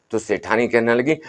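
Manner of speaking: ordinary delivery, nothing marked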